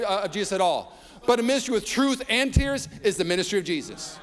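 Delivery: high, loud preaching build; preaching at full force. Acted. Pastor preaching truth and tears to the congregation, loud and high. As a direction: drive the point home from the pulpit.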